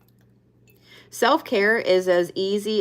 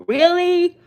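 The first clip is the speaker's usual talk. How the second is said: high, loud disbelieving "Really?"; genuinely taken aback by the card; real shocked squeal; surprised; 11 semitones higher, 6 dB louder than usual. Real, not acted. Reacting to a drawn card.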